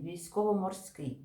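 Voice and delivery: ordinary talk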